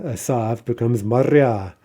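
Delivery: ordinary delivery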